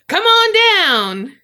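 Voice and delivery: loud, high announcer call; game show host. Acted. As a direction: belt it like a TV announcer. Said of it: "You're the next contestant on The Price is Right".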